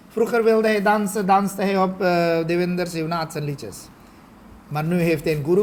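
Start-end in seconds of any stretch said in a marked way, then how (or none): none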